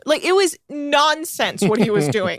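ordinary delivery

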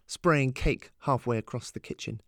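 ordinary delivery

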